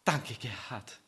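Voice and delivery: tender thanks. Acted. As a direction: thank him gently. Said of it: "Endlich spricht sie".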